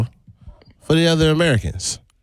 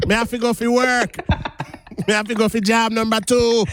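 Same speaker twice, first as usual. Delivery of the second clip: high, playful Jamaican patois voice; Jamaican accent; 10 semitones higher than usual. Retold or imitated. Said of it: "Chet might have a better Jamaican accent than me".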